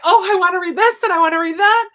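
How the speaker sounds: high, loud, eager wish-list voice; excited gushing. Retold or imitated. Friend recalls reading a booktuber's list, high excited gushing. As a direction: gush over every book on the list. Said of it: "I'm like".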